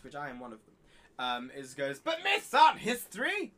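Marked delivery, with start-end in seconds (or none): 2.0-3.5 slow, much higher and louder mock pedant objection; mock a nitpicking pedant, slow and shrill-pitched. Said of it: "if any pedants in the audience".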